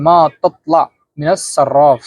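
মা তাতলা with a heavy emphatic তোয়া sound; careful model pronunciation. Shown as a demonstration. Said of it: "একটু উচ্চারণটা এমন হবে". (তোয়া জোর দিয়ে মা তাতলা)

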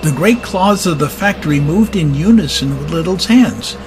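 plain reading voice

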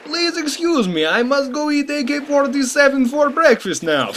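high, slow mock Russian accent; high-pitched, hammed-up Russian accent. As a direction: squeeze the Russian caricature up high. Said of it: "he added this little appendage".

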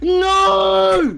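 loud dismayed cry; shout. Real, not acted. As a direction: yell no in dismay. Friend falls in lava, cry out.

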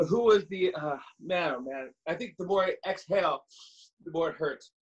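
normal talking voice

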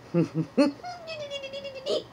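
very high cartoon giggle; a tiny high squeal of a laugh; squeaky puppet laugh. Puppeteer tries out a laugh for the puppet, a high squeal. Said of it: "That might be my laugh".

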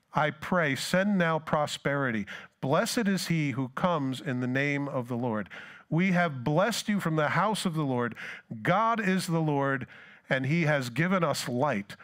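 preacher declaiming the psalm; preaching scripture. Acted. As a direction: proclaim the psalm with force. Continues psalm at full voice.

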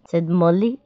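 ordinary delivery, nothing marked

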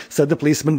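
plain reading voice

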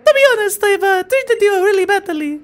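very high put-on girl voice, remorseful; high female game character, apologetic. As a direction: voice the girl way up, full of regret. Voicing game character Lillie.

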